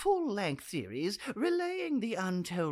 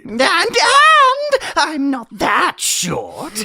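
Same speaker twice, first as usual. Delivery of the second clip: indignant shout; 6 semitones higher, 16 dB louder than usual. Acted. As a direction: bellow it like a wounded little man. Indignant prisoner protests his height.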